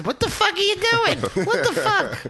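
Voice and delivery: high-pitched squawk of alarm; hammed-up indignant squeal; mock panicked friend. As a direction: imitate a flustered friend in falsetto. Mimics friend's panic as people attack.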